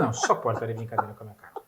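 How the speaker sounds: fast, incredulous retort; imitating his own disbelief; a touch of retold outrage; mock exasperation. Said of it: "Eu falei".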